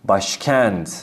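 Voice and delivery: normal talking voice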